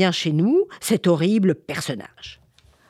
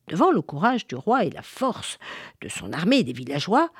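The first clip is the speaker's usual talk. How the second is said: plain reading voice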